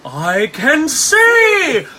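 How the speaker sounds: loud, high, long drawn-out cry; mock-ecstatic proclamation; hammed-up jubilation. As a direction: imitate a movie miracle moment, stretching every word. Acting out blind man's movie line.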